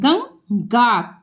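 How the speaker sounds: Ganga stretched syllable by syllable; slow sounding out. Shown as a demonstration. Teacher showing pupils how to sound out Ganga. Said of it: "जैसे ये हम दिखा रहे हैं".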